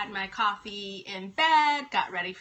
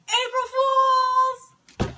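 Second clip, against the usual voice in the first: gleeful reveal; 12 semitones higher, 7 dB louder, faster than usual. Retold or imitated. Son springs the joke on mom, imitated gleeful shout. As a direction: imitate a kid crowing the punchline. Son's prank reveal, repeated.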